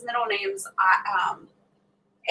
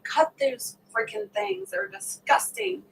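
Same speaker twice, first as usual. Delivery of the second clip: higher, slower mock disgust; disgusted retort; 10 semitones higher, slower than usual. Telling her aunt to cut her toenails, disgusted. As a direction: exaggerate the disgust, drawing it out.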